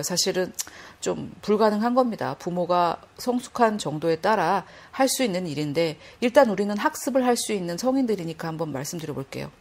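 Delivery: usual speaking voice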